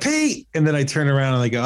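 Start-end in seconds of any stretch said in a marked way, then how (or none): 0.0-0.5 calling a name across the room, much higher; retold call to a coworker.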